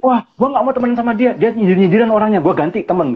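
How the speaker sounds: lightly voiced sulky person swearing off a friend; huffy friend-dropper. Speaker mimics a person who keeps changing friends, lightly huffy. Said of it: "ada orang gini kan".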